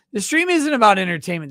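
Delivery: loud, fast mock rant; hammy streamer rant. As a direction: bellow it at chat in a hammed-up rant. Ranting at chat about grift.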